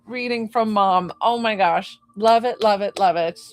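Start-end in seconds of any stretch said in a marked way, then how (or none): none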